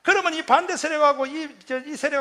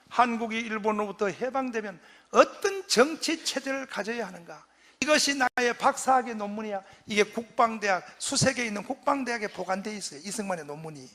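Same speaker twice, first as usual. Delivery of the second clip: ordinary delivery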